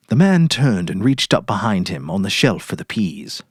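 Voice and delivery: usual speaking voice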